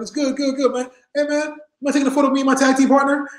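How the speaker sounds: eager reply. Retold or imitated. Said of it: "I was like".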